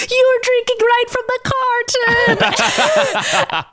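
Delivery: high, drawn-out mock outrage; Kurt scolding Kitty. Kurt catching Kitty at the milk jug, mock scolding. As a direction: scold in a slow, very high put-on voice. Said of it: "Kurt bamfs in and grabs it, and he's like".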